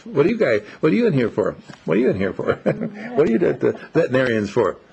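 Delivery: high, eager puppy voice, quick at the end; happy young dog. Retold or imitated. Young dog Rex greets an older dog at the vet, high and eager. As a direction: bounce in as a wiggly friendly dog. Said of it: "wiggly, goggly, happy guy".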